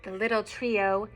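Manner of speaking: lilting storybook read-aloud; reading to children. A narrator's reading. Parent reading a picture book to children, lilting. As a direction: read the rhyme aloud to little ones.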